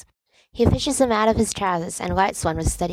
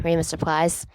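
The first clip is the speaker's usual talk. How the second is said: usual speaking voice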